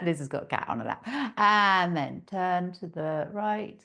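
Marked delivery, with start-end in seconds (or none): none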